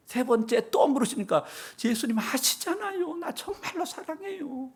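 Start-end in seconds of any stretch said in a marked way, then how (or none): 1.8-4.7 much higher, pleading voice. Acted. Peter pleading with Jesus on the third question: high plea. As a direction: beg him to believe you.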